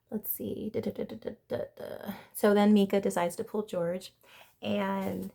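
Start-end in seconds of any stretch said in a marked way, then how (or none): 0.1-2.1 whisper. Real, not acted. Whispered filler while searching notes.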